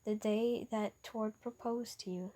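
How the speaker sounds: ordinary delivery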